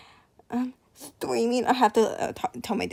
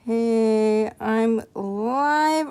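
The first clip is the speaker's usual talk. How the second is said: ordinary delivery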